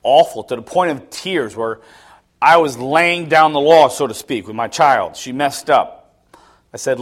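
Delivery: ordinary delivery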